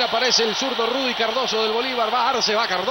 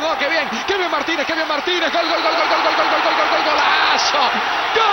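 rising into a louder gol chant; excited goal call; 4 semitones higher, 4 dB louder than usual. Real, not acted. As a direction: build from praise to a roaring goal call. Live goal call, building.

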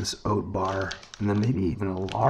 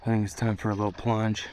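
usual speaking voice

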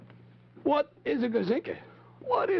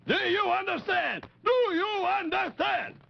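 stern demand; 7 semitones higher than usual. Acted. Chief demanding obedience.

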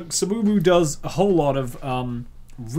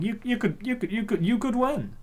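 high, stammered cheering-on; playful encouragement; 7 semitones higher than usual. Acted. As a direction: build up excitedly in a high voice. Excited encouragement to enter.

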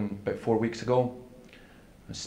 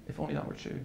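whispered echo; 4 semitones higher, 9 dB quieter, faster than usual. Retold or imitated. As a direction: repeat it as a soft, voiceless whisper. Woman's hopeless reply, repeated.

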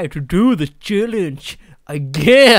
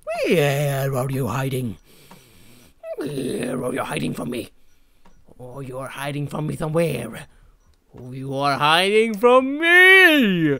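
creepy searcher voice; 7 semitones lower than usual. Pretend. Voicing the searching owner.

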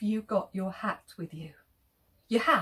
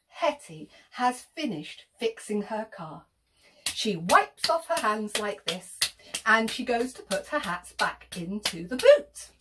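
plain reading voice

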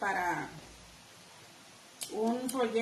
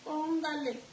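sassy mock reply; 3 semitones higher, 4 dB quieter, slower than usual. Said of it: "Yo nada más les escribo".

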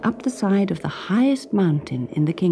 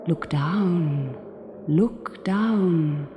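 airy, lightly voiced wind spirit; north wind; much slower than usual. A narrator's reading.

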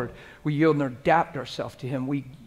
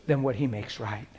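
ordinary talk

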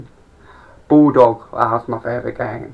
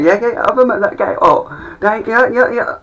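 sarcastic reply; 10 semitones higher, 4 dB louder than usual. Retold or imitated. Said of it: "I said".

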